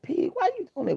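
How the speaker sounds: high, soft teasing scold to her daughter; a hushed, high-pitched mother's scold; mock scolding mom. Mother softly scolding her fighting daughter, high and hushed.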